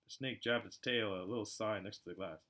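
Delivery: plain reading voice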